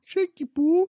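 high squeaky pet-name coo; baby talk. Pretend.

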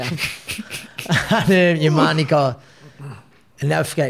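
ordinary talk, nothing marked

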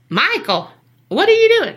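imitated country accent, pitch leaping up high. Passerby calls to Michael in the street, mock country accent. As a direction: call out loudly in a put-on country accent. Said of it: "why do they have a country accent".

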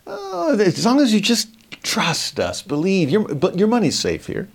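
high, put-on coaxing pitchman voice; smooth salesman. Babylon luring people to invest, high slick sales voice. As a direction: lure them in like a slick salesman.

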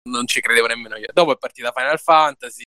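normal talking voice

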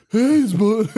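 [name] impression. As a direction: squeak out a failed [name]. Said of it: "I can't do his fucking voice".

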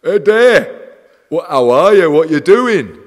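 loud, high mock greeting from a pestering fan; loud mock impression of an overeager fan on the phone; mock chirpy fan caller. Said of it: "And he'll go".

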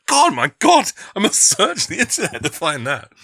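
high, loud fannish outburst; excited exclamation. Acted. Fan reacting to a surprise radio star, wildly excited. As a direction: play up the urgent, astonished discovery. Acting out urge to find episode.

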